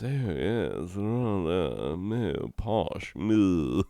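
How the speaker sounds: a hint of plummy English royal, lightly voiced; mock aristocrat mumbling posh noises; posh British. Germans who learned to talk like this, lightly mocking posh British. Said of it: "learn to talk like this".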